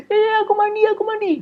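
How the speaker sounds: put-on pleading child. Child pleading under punishment.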